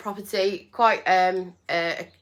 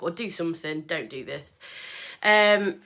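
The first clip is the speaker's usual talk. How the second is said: ordinary talk, nothing marked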